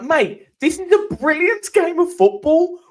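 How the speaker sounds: high, loud, slow incredulous yell at a mate; exasperated outburst. Retold or imitated. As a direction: throw it at him in disbelief: what is wrong with you. Retold exasperated outburst at Pete.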